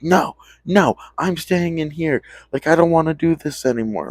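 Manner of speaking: high, pleading friend voice; whiny refusal. Retold or imitated. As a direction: whine it from behind the bathroom door. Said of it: "It's like".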